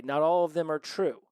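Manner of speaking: ordinary delivery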